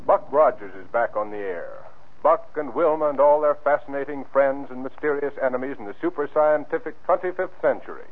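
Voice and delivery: normal talking voice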